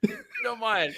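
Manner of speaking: very high, timid please; squeaky polite plea. Pretend. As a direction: beg sweetly in a tiny voice.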